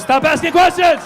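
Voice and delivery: high, loud mock outburst; acted fed-up father yelling; exasperated shout. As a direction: shout it to shut down the questioning. Exasperated father cutting off daughter.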